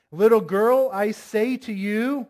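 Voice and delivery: loud, slowing command; emphatic, commanding Jesus; authoritative. Acted. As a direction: call her with authority. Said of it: "That sounds like he has authority there".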